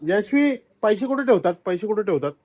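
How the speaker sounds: excited. Real, not acted. Loudly quizzing the child.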